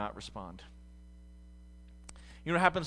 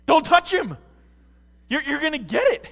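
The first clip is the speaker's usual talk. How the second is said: shouted, much higher warning; alarmed Peter; 11 semitones higher, 14 dB louder than usual. Retold or imitated. Peter warning Jesus not to touch the leper, shouting high. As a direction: yell at him to stop before he touches. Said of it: "I can imagine Peter being like".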